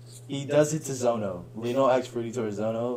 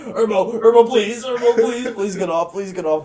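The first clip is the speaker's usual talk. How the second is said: high, loud begging, easing toward the end; mock desperate pleading; 8 semitones higher, 6 dB louder than usual. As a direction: imitate a pinned friend begging for release. Imitates friend begging to be released.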